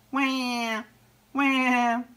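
high, drawn-out imitated wail; baby seal cry. A narrator's reading. Lost baby seal crying from the beach, high wail. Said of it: "the baby seal cried".

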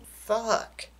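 ordinary delivery

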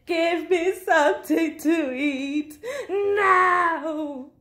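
high, slow, drawn-out yell; demanding shout. A narrator's reading. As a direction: wail the demand, stretching the last word. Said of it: "shouted Bird".

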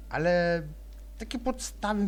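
ordinary talk

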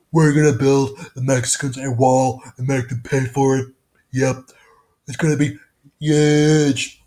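brash campaign-rally voice; Donald Trump. Shown as a demonstration. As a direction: boast it like a rally promise. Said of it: "So I'll do that one more time".

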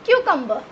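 Cucumber as "KYOO-kum-buh", no final r, falling; correct pronunciation. Shown as a demonstration. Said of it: "कैसे?". (Cucumber को "क्यूकम्बा" बोलें)

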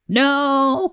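high-pitched cry of refusal. A narrator's reading. Witch fleeing the hungry dragon, crying out. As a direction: cry out high, drawing out the word. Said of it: "cried the witch".

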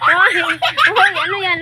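loud laughing hey; laughing shout. Real, not acted. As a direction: call out while laughing.

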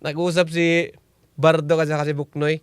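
ordinary delivery

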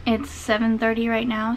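ordinary talk

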